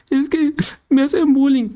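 voz muy aguda y chillona de niño; quéjate como niño herido; niño llorón. Retold or imitated. El niño se queja al papá.

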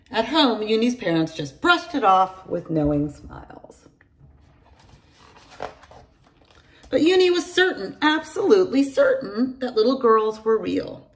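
put-on bright storytelling voice for kids; lively read-aloud for young children; story narration. A narrator's reading. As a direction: read it like a bedtime picture book. Reads a picture book aloud.

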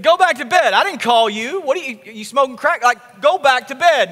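put-on a grumpy priest shooing the boy back to bed; annoyed priest.

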